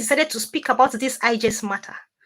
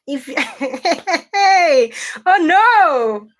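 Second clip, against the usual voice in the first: ordinary delivery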